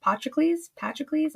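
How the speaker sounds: tentative try at Patroclus?, rising high; sounding out Patroclus? as a question; testing how to say Patroclus?; unsure pronunciation. Real, not acted. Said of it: "I don't know how to say that name".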